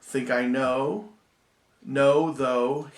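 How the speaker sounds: stressing the end rhymes; rhyming words stressed. Shown as a demonstration. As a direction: lean on the rhyme sounds, slow.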